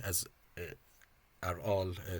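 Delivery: normal talking voice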